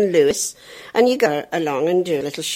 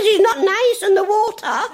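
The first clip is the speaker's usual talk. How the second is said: high, loud prim headmistress; 4 dB louder than usual. Retold or imitated. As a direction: tut like a shocked teacher. Headmistress voiced.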